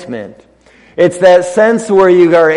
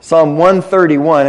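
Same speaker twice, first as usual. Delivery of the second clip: usual speaking voice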